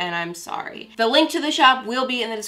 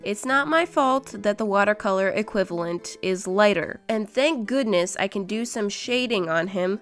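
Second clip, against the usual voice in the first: ordinary talk, nothing marked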